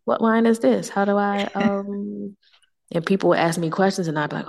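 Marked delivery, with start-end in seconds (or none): 0.0-2.4 hesitant, higher-pitched mock fumbling; socially awkward. Guest fumbling a wine question in French, mildly awkward. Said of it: "socially awkward".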